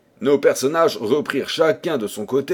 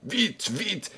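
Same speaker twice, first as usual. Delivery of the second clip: usual speaking voice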